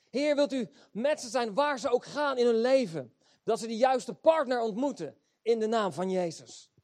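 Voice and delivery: a preacher's model prayer at full force; fervent prayer. Acted. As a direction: pray it out loud as if begging for your kids. Preacher voices fervent parental prayer.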